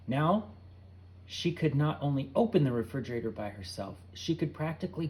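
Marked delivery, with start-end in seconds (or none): none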